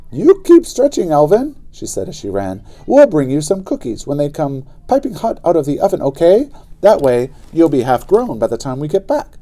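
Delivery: high, loud call over the shoulder; calling back. Acted. Sister calling while running.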